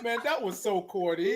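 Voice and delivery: soft, amused mock grumble; retold aside. Host retelling her reaction to Nita, soft amused aside. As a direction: say it quietly through a little laugh. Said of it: "you gotta say it like that".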